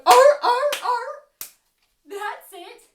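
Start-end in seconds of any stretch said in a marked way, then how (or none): 0.1-1.2 loud, high barking oh; seal bark. Shown as a demonstration. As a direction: bark like a sea lion. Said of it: "whack your flippers together and go".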